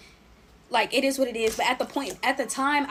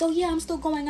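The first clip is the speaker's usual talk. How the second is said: breezy confirmation; 7 semitones higher than usual. Retold or imitated. Friends confirming they still go.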